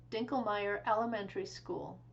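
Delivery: ordinary delivery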